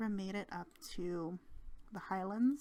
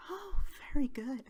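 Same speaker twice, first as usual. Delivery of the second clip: whispered praise; 6 semitones higher, 9 dB louder than usual. Retold or imitated. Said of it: "he was just like".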